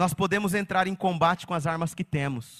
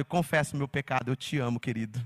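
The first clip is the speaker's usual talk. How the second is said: ironic confession; 6 semitones lower, 4 dB quieter than usual. Retold or imitated. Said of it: "O pastor também é irônico".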